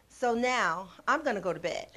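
ordinary delivery

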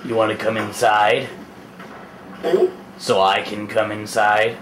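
low, creepy stalker murmur. Acted. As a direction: drop low and creep them out. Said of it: "you guys gotta do the whole stalker voice".

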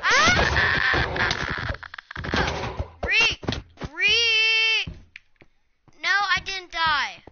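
panicked high scream; being chased. Real, not acted. Being chased by the Iron Golem.

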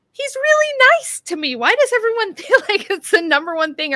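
high, loud indignant retort; high mock-exasperated self-quote; own retort. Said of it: "I'm like".

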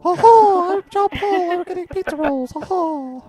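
high falsetto mocking impersonation; squeaky hooting parody of a friend; Lycan. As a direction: put on a silly high voice. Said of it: "pretend to be Lycan".

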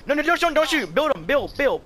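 high, loud frantic orders. Real, not acted. Player ordering teammate to build under fire, frantic. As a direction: fire out the words in panic.

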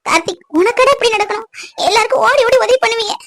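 high, tearful lament; a grieving mother crying. Acted. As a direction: weep as you beg him to leave the town. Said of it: "அழாதீங்கம்மா".

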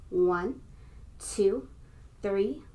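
ordinary talk, nothing marked

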